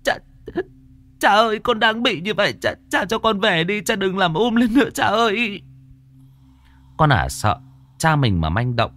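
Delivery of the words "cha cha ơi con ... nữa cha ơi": high, frantic begging; panicked pleading. Acted. Daughter begging her father not to fight, panicked. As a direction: cry out to stop her father. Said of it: "con Lài la lên".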